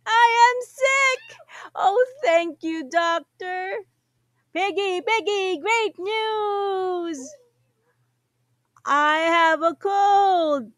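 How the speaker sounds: high, loud, happy shouting; overjoyed. Acted. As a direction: celebrate the good news. Character overjoyed at news.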